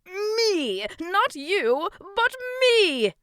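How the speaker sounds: high, booming, exuberant teaching; excited. A narrator's reading. King teaches the next syllable, excited shouting. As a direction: shout each word with glee. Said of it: "grew even more excited".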